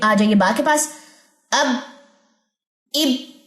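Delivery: usual speaking voice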